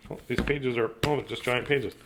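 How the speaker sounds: ordinary delivery, nothing marked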